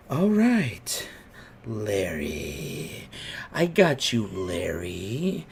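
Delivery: creepy owner renaming Rafi; creepy old man. Retold or imitated. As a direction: growl the wrong name slowly. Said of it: "he goes".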